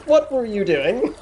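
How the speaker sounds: embarrassed sailor's look voiced; mock shocked question. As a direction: ask it in disbelief. Sailor's face says, mock voiced.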